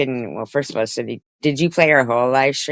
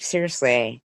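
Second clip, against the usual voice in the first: normal talking voice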